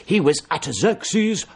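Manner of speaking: slow, drawn-out mock triumph; triumphant vicar. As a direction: proclaim it like a preacher reaching his climax. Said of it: "with enormous triumph".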